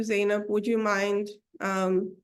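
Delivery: normal talking voice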